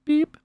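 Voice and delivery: high-pitched scanner beep; imitated checkout beep. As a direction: mimic a store scanner with a short high beep. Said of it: "It's just like, beep".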